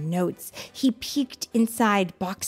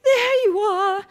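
mother's voice; 13 semitones higher, 6 dB louder than usual. Acted. Mother answers.

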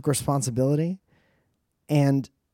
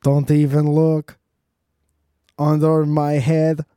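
loud, slow, higher robot voice; 3 semitones higher, 8 dB louder, slower than usual. Pretend. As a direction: ham up a fake robot. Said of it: "he was like".

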